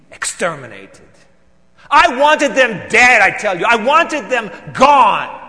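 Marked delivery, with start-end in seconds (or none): 1.9-5.4 loud, higher, slow and heavy; furious outburst. Acted. Pharisee to Pontius, wanting the apostles dead, furious. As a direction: let the hatred break out. Erupts in hatred.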